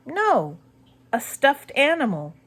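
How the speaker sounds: ordinary delivery, nothing marked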